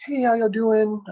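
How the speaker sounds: breezy greeting to a table; a light friendly waitress voice; imitated server greeting.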